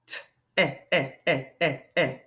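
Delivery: T, then short "eh" repeated in beats; sounding out. Shown as a demonstration. Teacher showing a short E sound, sounding it out. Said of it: "That is a short E sound".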